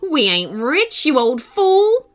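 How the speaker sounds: scornful, rising to a loud sneer; mock him for the foolish idea; sneering old woman. A narrator's reading. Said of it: "sneered Granny Grump".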